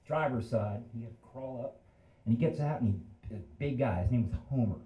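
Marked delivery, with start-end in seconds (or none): none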